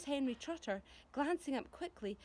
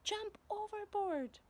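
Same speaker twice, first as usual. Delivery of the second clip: panicked cry; 10 semitones higher, slower than usual. Acted. Character panics aboard peach.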